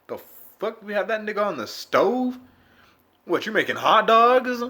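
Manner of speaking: high, loud mocking questions; mock incredulous. Mocking his burning dick, high and loud incredulous. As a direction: tease it like it's cooking.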